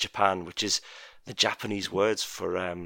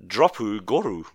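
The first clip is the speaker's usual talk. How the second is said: Japanese accent; 5 dB louder than usual. Shown as a demonstration. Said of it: "my best Japanese accent".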